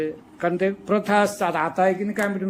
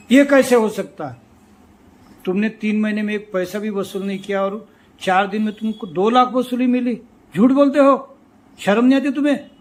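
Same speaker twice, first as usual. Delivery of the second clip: angry retort; 6 dB louder than usual. Retold or imitated. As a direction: build from sharp to furious. Clerk angrily confronts the official.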